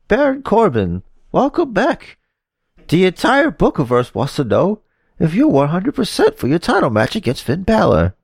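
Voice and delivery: high, bright female interviewer; Renee Young voice. Acted. Renee greets Baron Corbin in a high interviewer voice. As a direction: pitch way up for the reporter.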